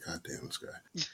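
breathy whispered mutter; muttered under breath. Retold or imitated. Brother shaking his head behind him.

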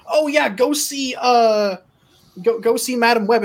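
mock promo pitch. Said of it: "you're like".

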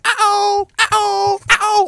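high squeaky imitation of the ICQ alert; ICQ uh-oh sound. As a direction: chirp it like the old ICQ notification. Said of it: "You remember that?".

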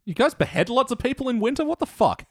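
high-pitched comic disbelief; mock incredulity. Co-host ribbing the beheading habit, high incredulous outburst. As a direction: react as if utterly baffled, pitch soaring.

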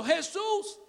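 ordinary delivery

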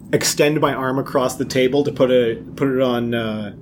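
ordinary talk, nothing marked

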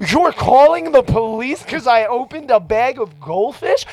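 high, loud incredulous protest; retold outraged disbelief. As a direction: argue back in indignant disbelief. Retold argument over police call.

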